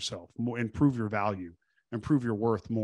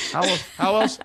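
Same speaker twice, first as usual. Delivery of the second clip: rising from firm to louder, higher; building emphasis; 8 semitones higher, 7 dB louder than usual. Real, not acted. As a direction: push the second how else up and out. Emphatic rhetorical repeat.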